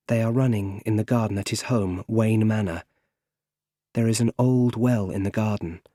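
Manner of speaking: ordinary delivery, nothing marked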